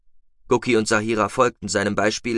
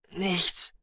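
high, strained little answer, softer; nauseous, forced out; 9 semitones higher, 5 dB quieter than usual. A narrator's reading. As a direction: force the word out through nausea. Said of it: "brachte sie heraus". (mühsam herausgebracht)

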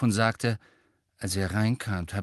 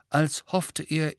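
ordinary delivery

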